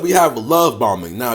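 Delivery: normal talking voice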